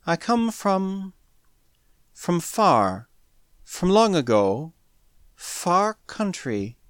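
slow, soft, broken alien speech; halting. A narrator's reading. Alien girl tells Smith of her origins, haltingly. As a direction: leave long gaps, groping for each phrase.

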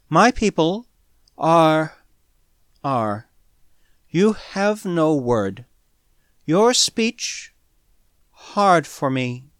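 slow, broken speech with long pauses; halting. A narrator's reading. Alien girl tries explaining her people to Smith, haltingly. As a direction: search for words that will not come.

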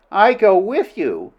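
high, slow, incredulous echo; astonished question. Acted. Princess questions Flynn's proposal to go with him, astonished. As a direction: ask it back in astonishment.

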